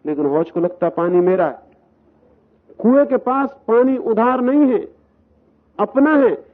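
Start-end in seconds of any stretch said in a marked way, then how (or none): 2.8-6.5 emphatic preaching. Real, not acted. Preacher's emphatic climax on the well.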